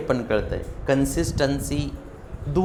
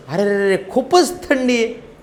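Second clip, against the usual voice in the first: shivering complainer; 7 semitones higher, slower than usual. Retold or imitated. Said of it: "तेव्हा म्हणत होता".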